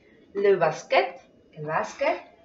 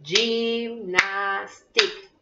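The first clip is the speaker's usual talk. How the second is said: "Gymnastique" very drawn out, gym-nas-tique; syllable by syllable; much slower than usual. Shown as a demonstration. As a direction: slowly count out the three syllables of "Gymnastique". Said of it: "¿Cuántas tendrá?".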